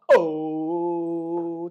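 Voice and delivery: loud, long held "ohhh"; drum-roll build-up. Pretend. Streamer teasing a super chat reveal, drum-roll build-up. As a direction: stretch out one loud, sustained oh.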